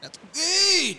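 imitating the actor's odd accent on that's great; parroting the actor's strange accent; mock French-tinged accent. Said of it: "he almost sounds French".

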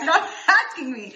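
slow, laughing protest; amused exasperation; laughing plea. Retold or imitated. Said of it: "If you ask me five more times".

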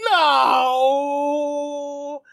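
hammed-up cry of despair; mock tearful wail. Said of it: "falls to his knees in tears".

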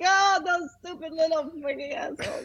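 put-on high-pitched, thick Hungarian accent; Hungarian mother. Mom cursing her kid's bullies, high Hungarian-accented impression. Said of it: "she's got that thick Hungarian accent".

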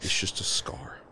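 whispered, voiceless narration; soft whisper of wonder; hushed reveal. Acted. Game master reveals the scar.

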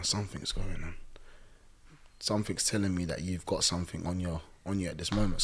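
low, hushed imitation of a pastor; retold pastor's low murmur; quietly probing. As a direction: softly press the point, low and brisk. Pastor pressing Maureen, retold.

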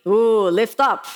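a hint of a strict teacher calling across the room; stern teacher. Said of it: "a little bit more sternly".

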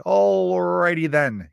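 loud, high, long-stretched catchphrase; Jim Carrey. Acted. Dad doing a movie catchphrase, hammed-up impression. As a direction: draw out the first word, big and goofy. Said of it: "You know, Jim Carrey".

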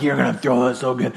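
low mock jock voice; pumped-up players. Players hyping each other before the game, mock pumped-up and low. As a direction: imitate a player hyping up a teammate. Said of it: "you all get all pumped".

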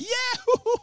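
high excited whooping; hype whoops. Retold or imitated. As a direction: whoop like hyped on stage. Hyping on stage.

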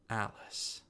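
breathless whisper, soft. Acted. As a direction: whisper her name in wonder. Finally sees his lost daughter.

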